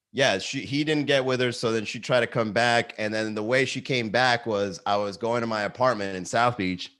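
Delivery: ordinary talk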